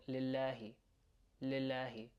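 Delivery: lillahi said quickly, L not held. Shown as a demonstration. Teacher showing what not to say, quick lillahi. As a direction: run through lillahi without lingering on the L. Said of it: "Just saying it quickly".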